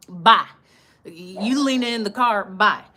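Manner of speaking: loud, hurried goodbye called to a neighbour; calling out bye. Retold or imitated. Leaving the chatty neighbour, a loud hurried goodbye. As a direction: shout bye to get away fast.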